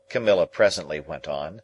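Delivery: usual speaking voice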